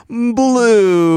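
very high, drawn-out toy voice; high mock-perky announcement; peppy toy lady. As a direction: imitate an over-peppy recorded toy lady. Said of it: "she's so much more peppy".

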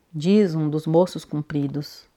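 ordinary delivery

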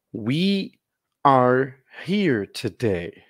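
slow, clear English model phrase; slow teacher demo. Shown as a demonstration. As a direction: spell it out slowly for learners. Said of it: "Gostaria que você lesse comigo". (leitura lenta e clara)

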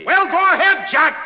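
loud, high urging; impatient director's shout. Acted. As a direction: bellow at the actor to get on with it. Director impatiently urges the actor on.

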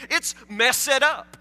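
MESSED drawn out as two syllables, mess-ed; wrong two-syllable ending. Shown as a demonstration. Preacher teasing Warren about his hair, "messed" said mess-ed. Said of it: "don't pronounce any other word like that".

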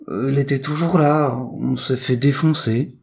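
high, sheepish character voice; high voice dragging out the bad news; battered twin reporting. A narrator's reading. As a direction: own up to the beating, high-pitched. Battered twin recounts the failure.

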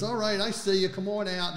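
a lightly voiced cop shouting into the woods; cop calling out. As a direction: call out like a cop bluffing.

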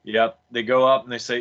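ordinary talk, nothing marked

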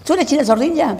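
very high, gushing excited squeal; enthusiastic girl. Retold or imitated. A young fan meets China at the cocktail party, squealing excitedly. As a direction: gush like a starstruck young fan. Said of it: "así muy entusiasta".

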